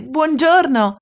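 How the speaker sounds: friendly hello with a smile; smiling greeting. Acted. Elmer smiling back at the animals, friendly hello. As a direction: answer kindly, smiling. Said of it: "diceva sorridente".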